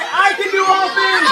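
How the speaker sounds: "I can do all things." called out for the crowd; out loud. Shown as a demonstration. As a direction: lead the kids in shouting it. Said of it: "Everybody say it out loud".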